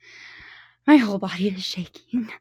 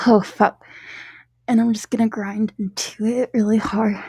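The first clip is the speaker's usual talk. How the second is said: ordinary delivery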